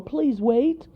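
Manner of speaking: high, loud plea to wait; crying out. Acted. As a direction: call him back urgently. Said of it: "cried the mother squirrel".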